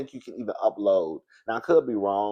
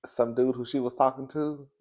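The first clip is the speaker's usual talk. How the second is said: ordinary talk, nothing marked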